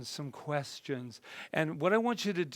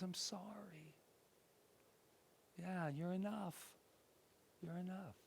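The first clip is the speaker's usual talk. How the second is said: soft reassurance; 3 semitones higher, 15 dB quieter than usual. Acted. Voicing healing words softly.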